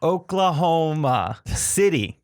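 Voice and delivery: very slow, drawn-out "Oklahoma City"; mock-patient, stretched pronunciation of "Oklahoma City"; slowly for her. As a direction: spell it out syllable by syllable, as if for a foreigner. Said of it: "saying it slowly for her".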